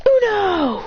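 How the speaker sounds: high, loud victory call; triumphant shout. Acted. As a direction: slam the last card down. Game finally ends.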